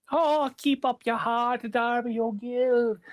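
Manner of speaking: high, slow calling fairy king. Acted. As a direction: call out from far off. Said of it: "cries out Brian Connors".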